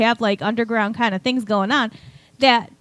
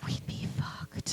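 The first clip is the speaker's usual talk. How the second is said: breathy whisper, slow; 13 dB quieter, slower than usual. Real, not acted. Friend whispering a dark joke to the table. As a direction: drop to a whisper for the punchline. Joking about immortal politician.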